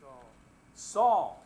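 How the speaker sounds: a loud, solemn call from heaven; voice of Jesus. Acted. Preacher voicing Jesus calling Saul, loud and solemn. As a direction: call his name out like the Lord speaking.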